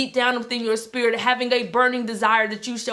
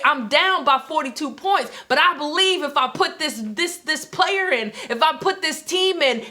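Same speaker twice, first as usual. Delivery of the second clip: rising fervent preacher voice; preaching; 4 semitones higher than usual. Acted. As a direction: preach it like a pep rally. Sermon-style inspirational pep talk.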